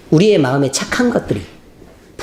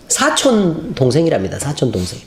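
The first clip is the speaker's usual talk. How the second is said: ordinary delivery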